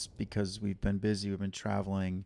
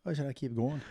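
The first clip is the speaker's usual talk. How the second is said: soft, higher Scottish lilt; Scottish accent; 4 semitones higher, 3 dB quieter, faster than usual. Pretend. Host asks co-host whether to go on in Scottish. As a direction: ask it in a teasing Scottish accent. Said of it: "I can keep talking like this".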